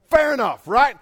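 ordinary delivery

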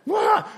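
high-pitched mock wail; squalling child. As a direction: imitate a kid bawling after a fall. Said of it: "that kid was squalling".

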